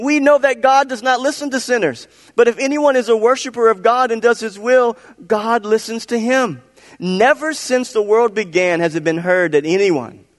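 high, fervent sermon from the healed man; preaching to Pharisees. Acted. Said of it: "he starts preaching to the Pharisees".

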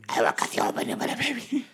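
mock threat.

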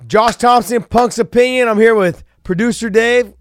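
loud, high announcer-style intro; hyped podcast host opening; show intro. Acted.

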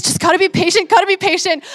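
forcing herself to stay patient; strained self-reminder. Retold or imitated. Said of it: "automatically I'm irritated".

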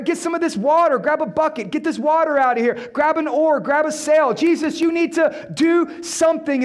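high-pitched, urgent imitation of a crew; mock frantic orders. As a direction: act out panicked commands in a storm. Acted frantic crew bailing out boat.

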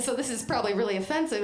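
usual speaking voice

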